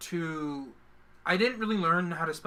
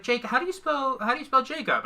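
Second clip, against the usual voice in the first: higher-pitched imitation of a teacher; 7 semitones higher than usual. Retelling a teacher asking him to spell, higher teacher voice. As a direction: lift the pitch like a teacher quizzing a child. Retold teacher's question to child.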